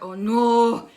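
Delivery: loud, drawn-out groan of dread; mock dismay. Child reacting to friend's bad news, loud mock dismay. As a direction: cry out in exaggerated dismay.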